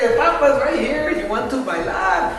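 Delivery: put-on dad hitting on young ladies at a wedding, leering and laughing; leering old man. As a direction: catcall the young ladies with a grin. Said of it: "making all these obscene and rude comments".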